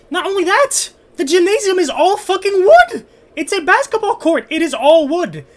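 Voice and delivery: high, loud, outraged rant; exasperated rant. Real, not acted. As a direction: rant like it's absurd. Ranting that the gym is wood.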